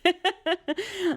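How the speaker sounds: high-pitched burst of laughter; real amused high laugh. Real, not acted. Laughs at the odd word.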